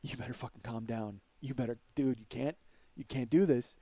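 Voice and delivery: low, hushed self-warning; inner voice. Retold or imitated. Man in his head telling himself to calm down, hushed. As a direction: talk yourself down under your breath. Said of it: "in my head, I go".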